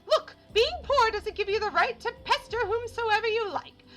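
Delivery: high, loud, indignant scolding; exasperated townswoman. Acted. Townswoman sending a beggar away, exasperated scolding. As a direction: tell off a pestering beggar in a shrieky put-on voice. Reads annoyed townsperson's game dialogue.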